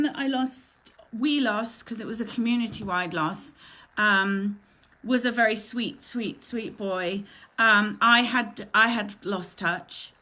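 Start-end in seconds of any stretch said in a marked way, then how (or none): none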